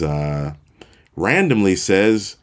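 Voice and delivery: normal talking voice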